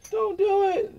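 very high falsetto plea; hammed-up pleading. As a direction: imitate a desperate plea in a squeaky high voice. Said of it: "I tried telling them".

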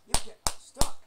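loud, breathy get unstuck; forced loud order. Pretend. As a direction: push the order out hard.

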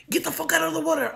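fast, loud, high acted yell; angry shouted order. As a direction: yell it like a furious cousin. Cousin orders her out, acted.

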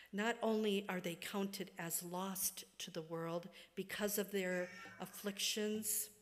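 ordinary talk

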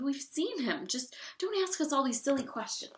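high-pitched indignant protest; whiny, exasperated objection; exclaiming. A narrator's reading. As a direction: complain in a high, pleading voice. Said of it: "they exclaimed".

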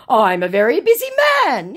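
mock declaration. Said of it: "he declares".

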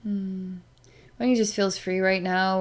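ordinary talk

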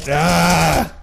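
loud, high yell of frustration; exasperated "aah" yell; frustrated scream. Real, not acted. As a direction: let out a wordless scream at the game.

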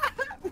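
high giggle. Real, not acted. Laughing at friend's Swedish joke.

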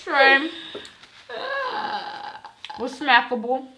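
loud, high presenter voice; vlog announcer. Acted. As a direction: pitch it up like a food review host. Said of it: "addresses viewers as guys".

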